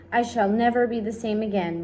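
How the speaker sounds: plain reading voice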